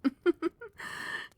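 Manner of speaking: high, light giggle; little laugh of agreement; amused giggle. Acted. Queen amused by her fiancé's remark, giggling.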